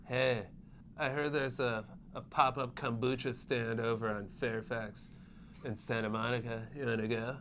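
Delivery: low, subdued Hollywood hipster; mock a laid-back L.A. brother. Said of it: "That's L A voice".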